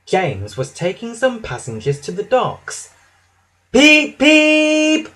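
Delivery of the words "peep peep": high, loud "peep, peep" toot; tooted train whistle. A narrator's reading. Thomas tooting as he speeds past James, piercing toot. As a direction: toot like a little engine whistle. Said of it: "tooted Thomas as he sped past".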